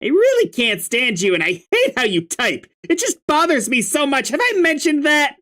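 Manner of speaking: irritated troll. Acted.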